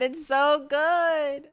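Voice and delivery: slow, high, drawn-out relish; savoring. Real, not acted. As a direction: linger on every word like a tasty bite. Savoring Cracker Barrel cornbread.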